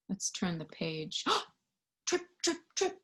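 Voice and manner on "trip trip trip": dance steps. Acted. Dancing steps sound.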